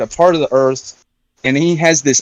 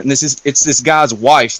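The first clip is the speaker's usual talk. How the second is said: usual speaking voice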